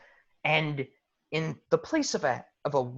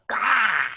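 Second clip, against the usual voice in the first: high, loud monster "gah"; magmin growling cry; 7 semitones higher, 5 dB louder than usual. Acted. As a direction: burst out with a startled creature yell. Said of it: "the magmen just go".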